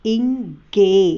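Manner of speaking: stretched ingay with hard g; wrong hard g. Shown as a demonstration. Teacher slowly showing the wrong hard g. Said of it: "इंजे नहीं बोलते".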